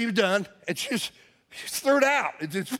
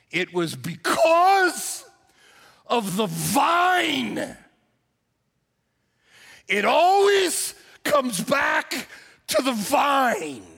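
preaching at full force; 4 semitones higher, much slower than usual. Acted. Pastor proclaiming the sermon's theme, slow full-force preaching. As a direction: drive the point home to the church.

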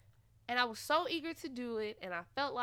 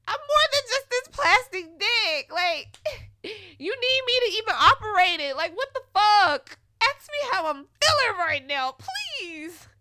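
much higher, loud, near-crying outburst; furious rant; 10 semitones higher, 12 dB louder than usual. Retold or imitated. Grieving and used by him, a very high, loud, tearful rant. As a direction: let it all out at him, hurt and furious.

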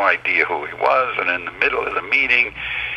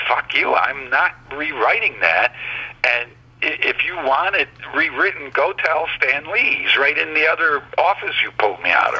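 higher-pitched retold outburst; mild mock yell, pitched up; retold angry bellowing; 7 semitones higher than usual. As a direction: recount blowing up at a boss, without really yelling. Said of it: "I finally exploded and started bellowing".

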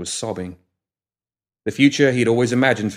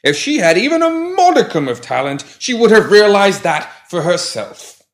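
put-on testy old gentleman; 11 semitones higher, 6 dB louder than usual. A narrator's reading. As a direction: huff it out like a fussy old gent. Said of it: "the pram retorted testily".

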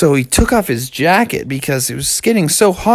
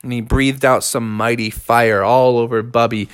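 ordinary delivery

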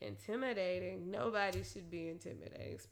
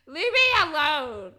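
louder, slow leave-me-alone; loud brush-off; 6 semitones higher, 17 dB louder, slower than usual. Retold or imitated. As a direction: push him away with a loud, slow line. Brushing off an unwanted approach.

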